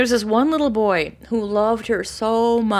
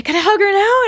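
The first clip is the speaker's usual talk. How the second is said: high, loud pleading question; eager boy; 14 semitones higher, 8 dB louder than usual. Retold or imitated. Boy begging to hug Tara, high and loud. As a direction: ask like a bouncing child. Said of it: "And he'd say".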